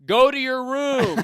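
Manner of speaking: high, slow, shouted parental order; strict parent. Pretend. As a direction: bark it like a furious parent. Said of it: "here's a good psychopath impression".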